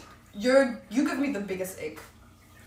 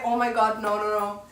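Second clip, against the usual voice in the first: ordinary delivery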